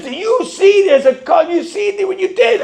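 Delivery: high, heated demand; furious accusation. Retold or imitated. Car owner demands the rabbi look, high and heated. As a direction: push him to see the damage. Angry stranger blames the rabbi.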